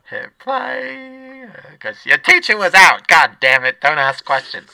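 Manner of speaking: high brash lunch lady; bossy cafeteria lady. Acted. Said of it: "I should just do both voices".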